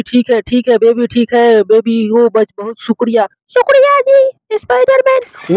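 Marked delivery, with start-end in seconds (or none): 3.5-5.6 put-on very high, slow little baby voice; slow high-pitched toy baby thanking Spiderman; baby saying thanks. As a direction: squeak out a grateful thank-you as the tiny baby. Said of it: "शुक्रिया बोल".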